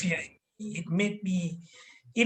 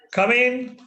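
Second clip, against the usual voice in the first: booming voice; 6 semitones higher, 5 dB louder than usual. Retold or imitated. Teacher calls a pupil into class, booming. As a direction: imitate a booming, higher, louder call. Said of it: "with a booming voice that".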